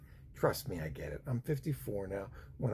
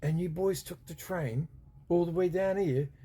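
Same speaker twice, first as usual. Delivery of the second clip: higher, louder disbelieving English copper; incredulous bobby; 8 semitones higher, 3 dB louder than usual. Retold or imitated. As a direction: doubt the boys' story as a policeman. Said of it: "and he's like".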